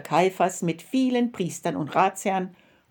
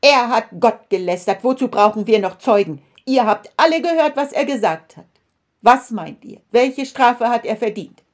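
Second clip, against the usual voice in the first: loud, indignant high priest crying out; high priest crying out in outrage to the council; outraged cry; 4 semitones higher, 7 dB louder than usual. A narrator's reading. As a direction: rage at the blasphemy, loud. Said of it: "zerriss ... sein Gewand und rief".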